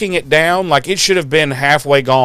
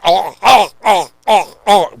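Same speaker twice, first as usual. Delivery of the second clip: repeated gulping "oh" noises, a bit higher; imitated gulping; 4 semitones higher, 3 dB louder than usual. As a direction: mimic someone gulping greedily. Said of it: "he's just like".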